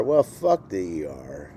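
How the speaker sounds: ordinary talk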